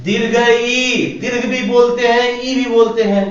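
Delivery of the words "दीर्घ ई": ई stretched slowly; teacher draws out ई slowly; long vowel demo. Shown as a demonstration. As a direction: hold the ई in दीर्घ ई long. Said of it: "थोड़ा देर तक बोलते हैं".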